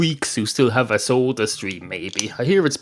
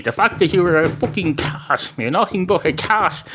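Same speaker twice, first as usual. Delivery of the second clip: higher-pitched, hammed-up exasperated insistence; acted exasperation; 7 semitones higher than usual. Barman insults a cat customer, in mock exasperation. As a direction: lose patience, pitch climbing, as a joke character. Barman loses patience, repeats with swearing.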